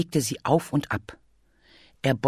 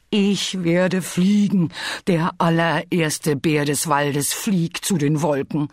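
grand proclamation; 2 semitones higher, 3 dB louder than usual. Acted. Bear announces the first flying bear, exultant. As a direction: proclaim it bigger, like a hero.

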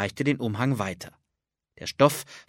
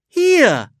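much higher, louder gleeful call; playful call; 12 dB louder than usual. Acted. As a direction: pop up out of nowhere. Invisible Anne answers close.

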